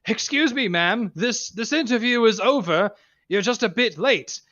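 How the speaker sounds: smug interviewer. Acted. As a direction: cut her off with a cocky grin. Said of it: "picks up the mic and goes".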